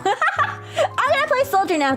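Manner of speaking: high, giggling through it; laughing. Real, not acted. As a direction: laugh the words out. Repeats line laughing, high.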